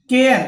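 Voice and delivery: केयर said loudly with an ए gliding into an आ; air sound demo. Shown as a demonstration. Teacher showing students how to say केयर. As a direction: stress the glide from ए to आ in केयर. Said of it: "ए और आ मिलके केयर बन रहा है".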